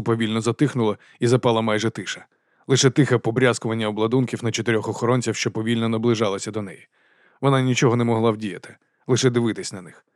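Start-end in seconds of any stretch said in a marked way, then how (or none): none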